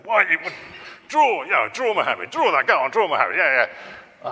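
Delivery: high, loud egging-on voice; loud goading. Retold or imitated. People daring the cartoonist, loud goading. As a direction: urge him on, loud and high-pitched.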